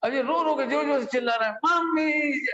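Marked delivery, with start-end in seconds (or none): 1.5-2.5 mock child wail. Child crying to mother.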